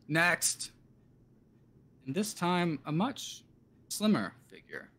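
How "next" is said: a called-out next, barely raised; yells. Acted. Guard summoning the next prisoner, mild call. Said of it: "the guard yells".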